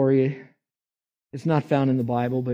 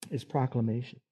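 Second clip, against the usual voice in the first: Is proclamation repeated much softer; preacher echoing his point, softer; 7 dB quieter than usual. Shown as a demonstration. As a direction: let the repeated proclamation drop back quietly. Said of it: "Number eight is proclamation".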